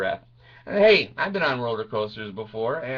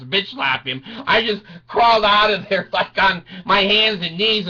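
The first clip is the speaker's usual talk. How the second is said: ordinary talk, nothing marked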